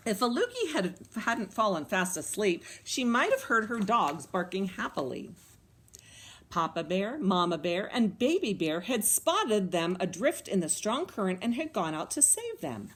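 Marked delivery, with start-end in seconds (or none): none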